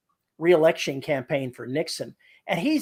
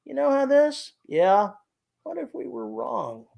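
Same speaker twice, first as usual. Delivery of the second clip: deadpan; 9 semitones higher than usual. Retold or imitated. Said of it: "that deadpan voice".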